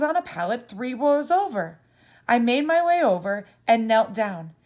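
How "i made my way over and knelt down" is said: ordinary delivery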